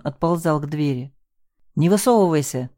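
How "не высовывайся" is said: ordinary delivery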